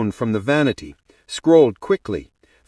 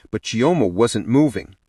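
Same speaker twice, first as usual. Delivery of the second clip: usual speaking voice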